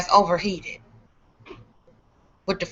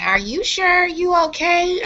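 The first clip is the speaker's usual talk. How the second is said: higher, worried lady's voice; concerned lady; 9 semitones higher than usual. Retold or imitated. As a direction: play the fussing neighbour lady, pitched up. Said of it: "One lady kept saying".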